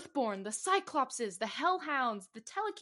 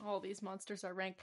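quiet; 3 semitones lower, 10 dB quieter than usual. Retold or imitated. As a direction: say it softly, thinking it over. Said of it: "I didn't get it until just now".

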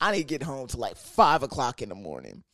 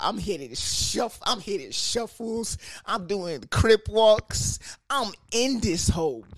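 hyped brag; 8 semitones higher, 4 dB louder than usual. Retold or imitated. Bragging about dancing moves.

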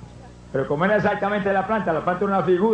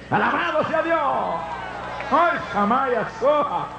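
a high shout of a preacher; preaching at full force; 4 semitones higher than usual. Acted. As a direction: preach the rejection with rising fire.

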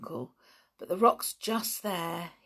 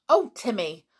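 mildly exasperated, raised voice; mild exclamation; 4 semitones higher, 8 dB louder than usual. A narrator's reading. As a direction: chide the dog lightly. Relenting to the fawning dog.

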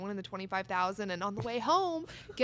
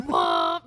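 mock engine womp; 9 dB louder than usual. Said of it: "I'm like".

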